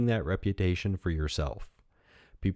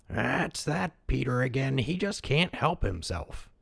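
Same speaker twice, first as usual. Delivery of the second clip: put-on higher, slow, resigned old villager; weary old man; 6 semitones higher, slower than usual. A narrator's reading. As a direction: tell the other villager it's only Peter.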